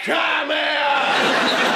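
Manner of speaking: high, stretched-out holler; calling the dog. Acted. Owner calls his dog by second name, hammed-up shout. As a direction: holler the command at a runaway dog. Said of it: "I call her".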